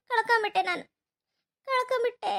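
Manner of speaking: grieving words dissolving into sobs; tearful, collapsing into a sob; crying. Acted. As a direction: repeat the loss until you break down weeping. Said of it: "Hemavathi, ಅಳ್ಬೇಡವ್ವ".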